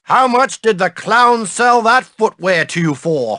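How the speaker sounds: ordinary talk, nothing marked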